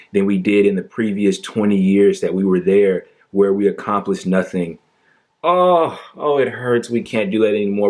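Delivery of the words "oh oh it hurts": higher, hammed-up fake pain; mock anguish. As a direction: whimper it sarcastically. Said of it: "I'm on the verge of crying".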